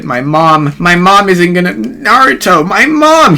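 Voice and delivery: building from worry to high, loud shriek; mock screaming. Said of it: "fucking screaming at this point".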